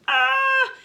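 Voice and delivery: sudden high, loud shriek; scary scream. Shown as a demonstration. Author showing children the scary noise, high loud scream. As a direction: let out a piercing demonstration scream. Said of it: "because of this scary noise".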